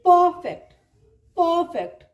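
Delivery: "परफेक्ट" with a long first uh and no r; lengthened vowel, silent R. Shown as a demonstration. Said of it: "इसके sound को थोड़ा सा लंबा करेंगे".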